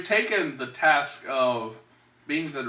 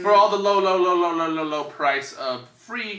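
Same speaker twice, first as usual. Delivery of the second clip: huckster sales pitch, louder; snake oil seller; 4 semitones higher, 5 dB louder than usual. Pretend. Infomercial pitch, low low price.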